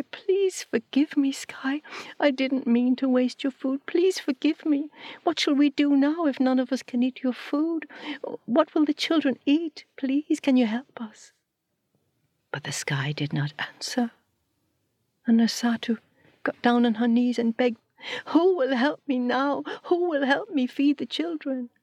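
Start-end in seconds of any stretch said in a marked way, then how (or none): 0.0-11.3 much higher, begging forgiveness; high desperate pleading. Acted. Asatu begging Sky, high and desperate. 18.3-21.7 much higher, crying out for help; high despairing plea. Acted. Asatu on her knees, high and pleading.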